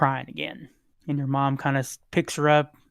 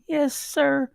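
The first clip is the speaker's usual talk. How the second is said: put-on a much higher, small child's voice; lightly voiced young girl; 10 semitones higher, slower than usual. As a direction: speak up high like a little girl answering. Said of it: "she says".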